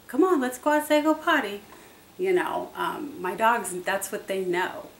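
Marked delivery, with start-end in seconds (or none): 0.1-1.6 higher and louder, bright and eager; excited dog call. Retold or imitated. Calling the dogs outside to potty, high and excited. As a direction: cheer the dog out the door. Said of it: "for my dogs, it is".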